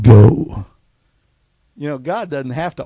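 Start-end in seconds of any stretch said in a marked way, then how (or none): 0.0-0.6 low, loud, booming command; a deep divine order, one word; voice of God. Retold or imitated.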